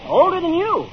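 high, loud exasperated retort. Acted. Salesman answering a literal-minded little girl, exasperated. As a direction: throw the question back, losing patience.

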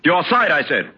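sharp command to a servant; barked order. Acted. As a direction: order him sternly to stand to attention. Master orders servant to attention.

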